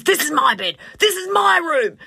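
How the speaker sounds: high, smug claim over the room; possessive claim. Retold or imitated. Guest staking his claim to the best room, high and smug. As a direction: lay claim to the room, pitched well up.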